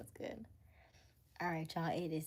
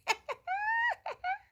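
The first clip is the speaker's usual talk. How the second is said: squealing high laugh; high burst of laughter; 5 dB louder than usual. Real, not acted. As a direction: let out a sudden high laugh. Laughing at own joke.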